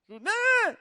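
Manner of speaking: calling out. Retold or imitated. Boy calling his friend out to play, loud call. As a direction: shout a friend's name at his door. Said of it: "o moleque gritando".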